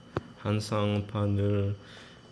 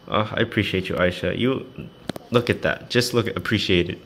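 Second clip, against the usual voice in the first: loud, higher thrilled outburst; excited shouting; 5 semitones higher, 6 dB louder than usual. Real, not acted. Thrilled by a fan's drawing, shouting loudly. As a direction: gush loudly over the gift.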